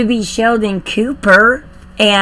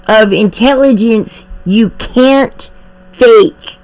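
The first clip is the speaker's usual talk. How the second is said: usual speaking voice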